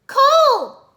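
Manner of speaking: very high, loud worried call; worried shout. A narrator's reading. Irene cries out to Cole in worry, loud shout. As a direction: cry out his name in alarm. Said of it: "Irene shouted worriedly".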